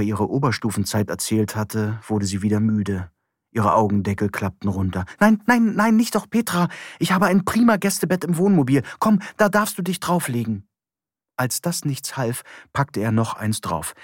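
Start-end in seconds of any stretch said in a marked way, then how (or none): 5.2-10.7 higher, pleading and quick; Johann's voice, urgent. A narrator's reading. Johann begging sleepy Petra into the camper, high urgent voice. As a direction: hurry to stop her nodding off, pitched up.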